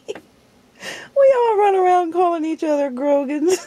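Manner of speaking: slow, crying with laughter; tearful laughing shout. Real, not acted. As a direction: laugh so hard it turns to tears. Laughing hard about family joke.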